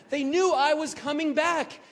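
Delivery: overjoyed cry; peak of joy. Real, not acted. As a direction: shout it to the sky. Repeated, louder still.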